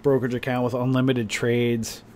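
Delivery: normal talking voice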